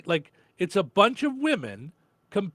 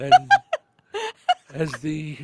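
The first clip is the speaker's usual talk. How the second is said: nature documentary narrator; 3 semitones lower, slower than usual. Pretend. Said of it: "Australian accent should be narrating".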